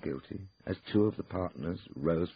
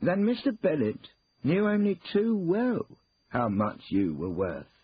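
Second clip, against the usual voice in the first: pointed; 12 semitones higher, 6 dB louder, slower than usual. Acted. Lawyer pointedly contradicts Ruth.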